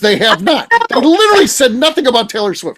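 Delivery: high, worked-up protest; angry, exasperated. Real, not acted. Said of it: "That's what angers me about it".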